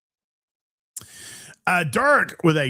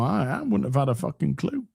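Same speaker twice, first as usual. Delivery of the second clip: ordinary talk, nothing marked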